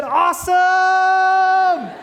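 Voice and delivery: high, loud, long-held holler; sustained whooping shout; triumphant yell. Acted. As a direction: stretch it out in a huge elated yell. Friend's triumphant yell acted out.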